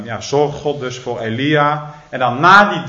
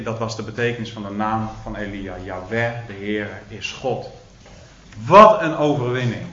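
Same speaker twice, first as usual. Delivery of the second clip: usual speaking voice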